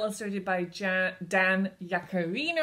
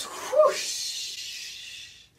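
high breathy whoosh; whooshing sound. Acted. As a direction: whoosh like a swinging ball. Wrecking ball swings.

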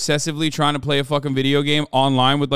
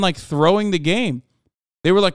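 ordinary talk, nothing marked

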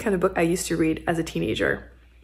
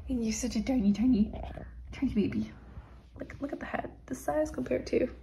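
tender whisper to a tiny kitten; 4 semitones higher, 10 dB quieter than usual. Real, not acted. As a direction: whisper fondly to the little one. Whispering baby talk to kitten.

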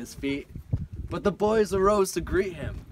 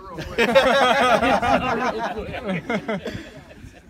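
laughing; 10 semitones higher, 11 dB louder than usual. Real, not acted. Laughs before starting poem.

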